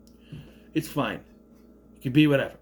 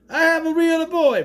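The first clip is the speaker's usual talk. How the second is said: high, loud-ish Italian Geppetto; mock Italian accent; 10 semitones higher, 4 dB louder than usual. Retelling Geppetto's line with an imagined accent, mock Italian. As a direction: exclaim it in a put-on Italian accent. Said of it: "he doesn't have the Italian accent".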